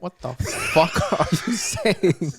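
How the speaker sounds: laughing. Real, not acted. Friend confused, laughing.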